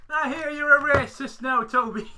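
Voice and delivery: high, loud put-on Irish accent; Father Ted quote. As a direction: greet him in sitcom Irish. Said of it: "It's just Father Ted quotes now".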